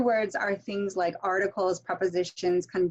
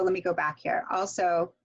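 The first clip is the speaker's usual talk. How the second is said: normal talking voice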